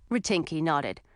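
plain reading voice

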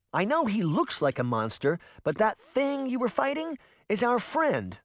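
upset. A narrator's reading. Sabrina desperately pleads.

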